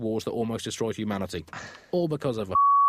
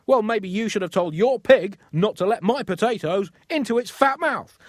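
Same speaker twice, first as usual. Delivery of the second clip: higher and louder, building to a squeal; hammed-up farmer yelling back; mock angry rant; 9 semitones higher, 5 dB louder than usual. Farmer shouting at pig owner over potatoes, mock angry. Quarrel escalates between farmers.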